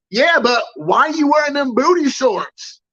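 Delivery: high-pitched mock kid demanding an answer; high, drawn-out insistent question; loud insistent kid. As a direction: imitate a kid who won't let it go. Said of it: "this kid is saying it loud".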